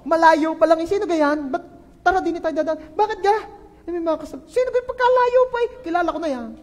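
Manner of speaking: high, excited mock gossip; mock gossiping neighbour. Pastor mimics someone dodging a foe, high mock gossip. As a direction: chatter like a nosy neighbour spotting an enemy.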